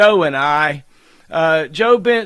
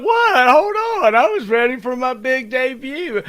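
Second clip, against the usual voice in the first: much higher playful voice for the guest; mock guest protest; 6 semitones higher than usual. Host voicing his guest's mock complaint, in a much higher playful voice. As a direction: squeak it out as the shy guest pretending to protest. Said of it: "She's like".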